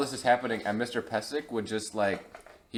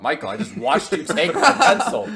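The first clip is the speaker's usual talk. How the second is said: loud, raised, half-laughing protest; mock indignant accusation; 5 semitones higher, 11 dB louder than usual. Student catching Michael stealing a pencil, mock indignant. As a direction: accuse him loudly, playing it for laughs. Acted quarrel over stolen pencil.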